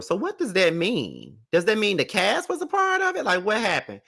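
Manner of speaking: high, baffled questions; confused and disbelieving; incredulous disbelief. Real, not acted. Host puzzled by [name] remark.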